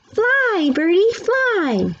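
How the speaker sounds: put-on a toddler urging himself to fly. A narrator's reading. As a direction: cheer yourself on like a tiny boy. Toddler Fudge about to jump.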